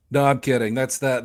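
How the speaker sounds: ordinary talk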